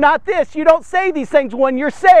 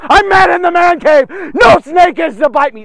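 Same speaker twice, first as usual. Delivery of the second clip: loud, higher furious bluster; angry boasting shout; 5 semitones higher, 8 dB louder than usual. Retold or imitated. Preacher reenacting his old self before he was saved, loud angry shout. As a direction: bellow it like a man nobody dares touch. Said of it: "that was my mentality at the time".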